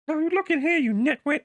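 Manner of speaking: very high mock-stern scolding; hammed-up professor telling someone off; Jordan Peterson impression. Peterson impression scolding again, very high. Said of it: "I probably sounded nothing like Jordan Peterson".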